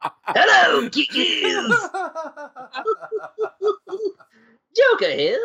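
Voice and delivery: Joker voice. Pretend. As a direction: play the Joker accepting an award. Friends playing Joker accepting award.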